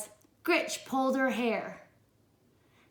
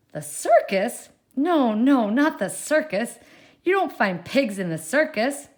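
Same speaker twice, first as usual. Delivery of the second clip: scoffing witch; 3 semitones higher, 7 dB louder than usual. Acted. Witch rejects own idea.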